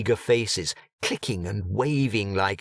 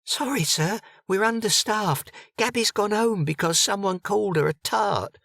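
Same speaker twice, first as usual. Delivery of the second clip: higher, hurried barmaid voice; busy barmaid; 12 semitones higher than usual. A narrator's reading. Harried barmaid apologises to Egbert while rushing, higher. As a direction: apologise on the run, up high.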